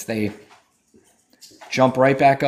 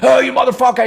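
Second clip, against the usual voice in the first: mock caller rant; 10 semitones higher, 8 dB louder, faster than usual. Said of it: "get your stupid fucking words and go".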